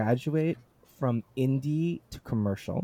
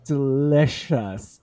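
slow, louder, savoured word; savouring relish; 2 semitones higher, 5 dB louder, much slower than usual. Real, not acted. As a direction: draw it out with relish. Relishing the passage just read.

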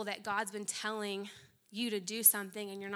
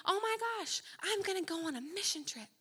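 breathless mock announcement; 9 semitones higher than usual. Mock excited whisper.

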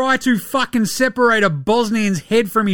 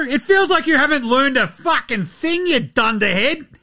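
ordinary delivery

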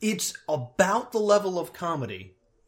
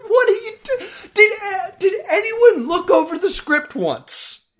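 high, loud laughing outburst; laugh-cry in disbelief; 9 dB louder than usual. Real, not acted.

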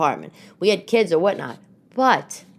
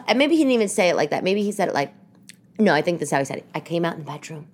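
usual speaking voice

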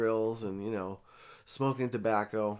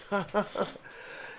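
breathy laugh; 8 semitones higher, 4 dB quieter than usual. Real, not acted. Laughing as story ends.

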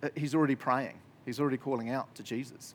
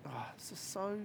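awed whisper; 6 semitones higher, 12 dB quieter than usual. Retold or imitated. Overwhelmed by good email.

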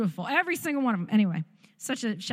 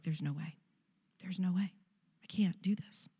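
much softer, sinking into a whisper; quiet despair; 3 semitones lower, 8 dB quieter than usual. Retold or imitated. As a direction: give up under your breath. Telling husband she can't go on.

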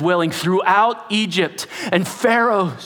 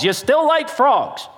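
higher, slow, teasing question; mocking God; 9 semitones higher, slower than usual. God taunting the frog-worshipping Egyptians, slow teasing. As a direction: taunt it slowly. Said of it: "God says".

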